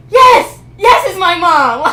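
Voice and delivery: high, loud, slow embarrassed answer; loud, drawn-out embarrassed reply; exasperated loud yes. Retold or imitated. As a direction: blurt it out half laughing, half crying.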